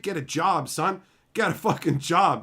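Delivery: ordinary delivery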